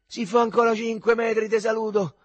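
loud, high-pitched exhausted protest; shouting. A narrator's reading. Lying flat in the road, shouted complaint. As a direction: cry out that you are done. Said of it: "gridò".

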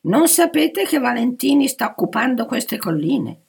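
loud, high-pitched rebuke; reproachful. A narrator's reading. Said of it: "rimproverò la guida". (rimprovero a voce alta e acuta)

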